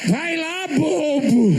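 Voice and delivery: high mock call of bobo; cheering on. Preacher calling bobo across the church. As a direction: call out bobo like a fan in the crowd. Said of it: "E eu".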